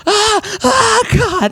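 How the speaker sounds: high mock wail of pain; hammed-up high groan of pain; pained cry. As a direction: cry out as if legs broke. Morty in pain after fall.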